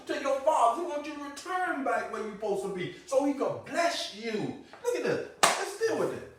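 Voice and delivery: loud, high, laughing exhortation; a fervent high-pitched pulpit shout; fiery preaching. Acted. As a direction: rouse them with a loud, high call. Loud preaching, rising fervor.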